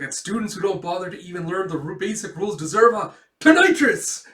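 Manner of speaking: fast tirade building to a loud high cry; acted disciplinarian outburst; stern punisher. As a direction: bellow the punishment at the end. Schlag sentences the student.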